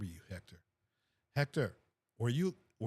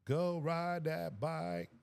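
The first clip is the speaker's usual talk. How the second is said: slow, each word drawn out, go leaning in; stretched-out send-off; 4 semitones higher, much slower than usual. Real, not acted. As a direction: savor the words like a fond farewell. Second take, stretched out.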